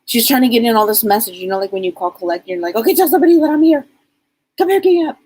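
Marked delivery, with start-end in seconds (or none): 2.8-5.2 rapid, higher and louder rush of words; talking really fast. Retold or imitated. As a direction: blurt it all out fast and urgent. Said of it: "She's talking like that, like, really fast".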